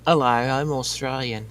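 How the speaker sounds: badly faked Australian accent; mock Aussie introduction; horrible Australian accent. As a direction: put on a hammy, unconvincing Australian accent. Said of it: "That was a horrible Australian accent".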